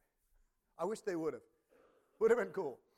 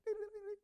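sung organ flourish, much higher; organ riff imitation. Preacher imitates the church organist's riff, sung high. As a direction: mimic the gospel organ stab in falsetto. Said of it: "the organ player goes".

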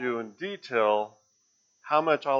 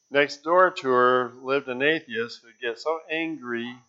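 ordinary delivery

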